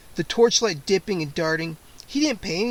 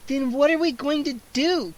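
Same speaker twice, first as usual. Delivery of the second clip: put-on much higher shrill girl demanding; 9 semitones higher than usual. A narrator's reading. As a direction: panic into a demand. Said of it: "Kara demanded shrilly".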